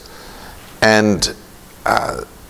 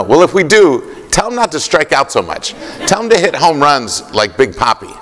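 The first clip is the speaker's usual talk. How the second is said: put-on raised pitch, earnest kid giving orders; little boy's advice; 7 semitones higher than usual. Boy tells the executive what to tell Millar, in a higher, earnest child's voice. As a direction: sound like a small boy telling the grown-ups what to do.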